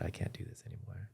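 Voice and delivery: a worn-out whispered confession; exhausted whisper. Retold or imitated. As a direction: let it out on a breath, spent. Exhausted morning after sleepless night.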